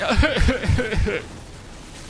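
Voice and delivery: bright laugh after the joke; big laugh. Real, not acted. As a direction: laugh out loud at yourself.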